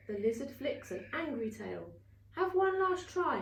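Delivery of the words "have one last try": high, louder sharp order; impatient threat. Acted. Wizard Lizard threatening Superworm, impatient. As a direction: warn him it is the last chance.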